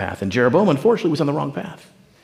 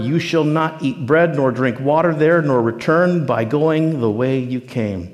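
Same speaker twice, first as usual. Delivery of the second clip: solemn decree; slower than usual. Acted. Quoting the word of the Lord.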